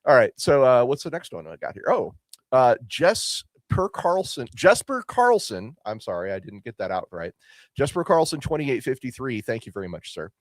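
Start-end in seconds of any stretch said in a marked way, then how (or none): none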